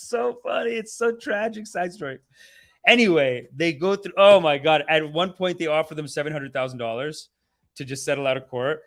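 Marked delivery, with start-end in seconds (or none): none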